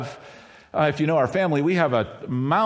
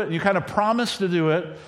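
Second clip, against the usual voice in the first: ordinary talk, nothing marked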